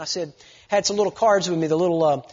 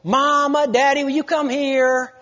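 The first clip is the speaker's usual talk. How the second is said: mock child's whine, higher; whining child; 6 semitones higher, 4 dB louder, slower than usual. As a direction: imitate a whiny kid calling. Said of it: "children or grandchildren whine to you".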